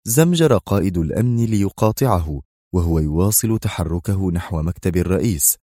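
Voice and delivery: usual speaking voice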